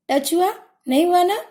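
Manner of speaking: ordinary talk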